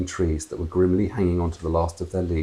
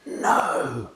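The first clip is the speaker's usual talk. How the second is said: shocked; 5 semitones higher than usual. Acted. Jaw fell open.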